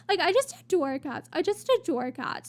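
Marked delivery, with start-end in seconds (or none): none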